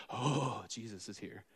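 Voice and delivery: low and dropping almost to nothing; a frightened aside under the breath; hushed dread. Retold or imitated. Demons fearing Jesus arriving.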